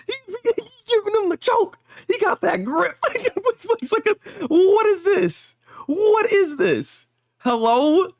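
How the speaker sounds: very high, loud incredulous outburst; shocked disbelief. Real, not acted. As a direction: squeal in stunned amazement, stretching the questions. Shocked reaction to choking scene.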